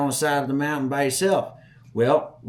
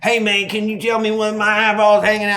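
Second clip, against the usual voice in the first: high, loud, pressing demand; re-enacted frantic question; urgent shouting; 9 semitones higher, 9 dB louder, faster than usual. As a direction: holler for an answer from your friend.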